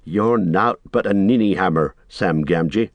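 ordinary delivery

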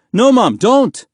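loud, high-pitched warning; alarmed cry. A narrator's reading. Boy warning his mother away from soldiers, alarmed cry. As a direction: cry out to hold her back. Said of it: "Mahmoud cried".

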